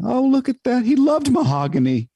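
a doting mother gushing over wood; imitating his mother. Son retelling mom picking a coffin, high gushing voice.

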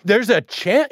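high, incredulous rising questions; replaying his own shock at a friend's answer; stunned disbelief. Friend admits vaccine odds aren't zero, stunned high disbelief. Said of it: "And I was stunned".

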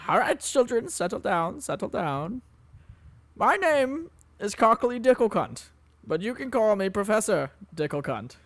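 high, pompous lecturer voice; Professor Dicklecunt. Acted. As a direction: lord it over the class, up high. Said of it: "I'm introducing myself".

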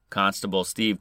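usual speaking voice

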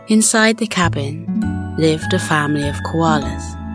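ordinary delivery

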